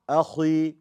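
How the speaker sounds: overdone thick khi in "Akhi"; super heavy khi. Shown as a demonstration. Teacher mocks a wrong reading, over-heavy khi in Akhi. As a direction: say Akhi with an exaggeratedly heavy kha. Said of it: "we don't talk like that".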